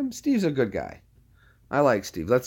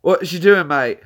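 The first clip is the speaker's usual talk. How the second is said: higher, louder put-on British mate; imitated British pal, voice pushed up; Brad; 5 semitones higher, 7 dB louder than usual. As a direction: repeat it loudly in a mock British accent. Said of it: "I was doing Brad's voice".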